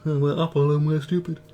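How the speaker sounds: silly corporate voice, a touch different; mock Apple voice. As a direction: mimic a dumb company spokesman. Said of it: "That's exactly how they talk in the office".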